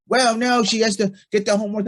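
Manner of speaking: hammed-up insistent wife defending homework; imitating wife. Wife voiced in retold fight.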